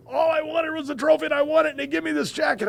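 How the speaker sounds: put-on high, fast, indignant child's complaint; mad kid. Grandson complaining to grandpa, high and indignant. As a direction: rattle it off like a furious boy. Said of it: "but he was mad".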